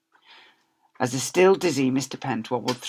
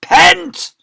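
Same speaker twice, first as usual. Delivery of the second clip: a very loud, very high shout; 16 dB louder than usual. Acted. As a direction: yell it from the top window.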